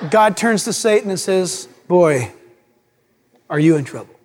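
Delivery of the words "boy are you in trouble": lower, stern warning voice; stern God. Acted. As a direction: deliver it like a grave judge. God scolding Satan.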